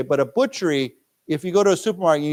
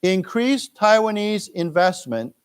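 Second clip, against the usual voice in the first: slow model reading, higher pitch; slow read-aloud; 5 semitones higher, slower than usual. Shown as a demonstration. Teacher reads line for students to repeat, slow. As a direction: read it slowly for the class to echo. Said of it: "let's read this".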